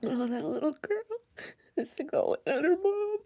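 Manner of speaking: high, breaking sobbing voice; crying. Real, not acted. Streamer hearing a little girl lost her mom, crying. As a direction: cry the words out in sympathy. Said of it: "Crying on YouTube is wild".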